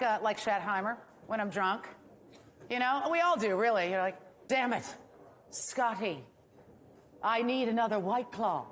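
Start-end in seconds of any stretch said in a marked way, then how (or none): 4.5-8.7 put-on Scottish accent. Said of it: "That was actually a Scottish accent".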